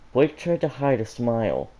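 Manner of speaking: ordinary delivery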